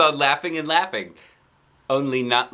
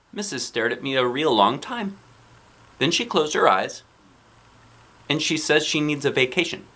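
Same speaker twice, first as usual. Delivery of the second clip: plain reading voice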